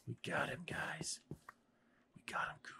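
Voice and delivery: whisper. Real, not acted. Gloating after the kill.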